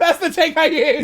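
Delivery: high, loud and slow through laughter; can barely get it out for laughing. Real, not acted. Laughing at the recorded take.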